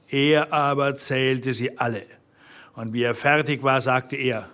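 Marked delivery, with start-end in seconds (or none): none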